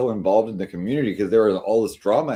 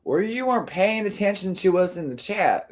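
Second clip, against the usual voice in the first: higher whiny mock of the friends; mocking complaint; 10 semitones higher than usual. Streamer mimicking friends griping about chat. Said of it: "what happened the next day".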